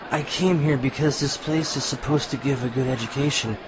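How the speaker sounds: ordinary talk, nothing marked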